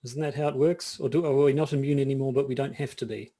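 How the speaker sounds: normal talking voice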